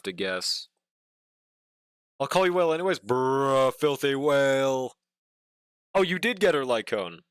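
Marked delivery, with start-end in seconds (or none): 3.0-4.9 teasing drawl. Real, not acted. Teasing viewer.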